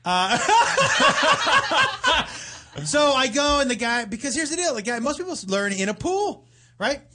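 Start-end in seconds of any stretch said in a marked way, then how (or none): 0.4-2.8 high, loud real laugh; big laugh. Real, not acted. Laughing at his own crude snorkeling joke, loud laugh.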